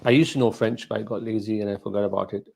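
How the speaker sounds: normal talking voice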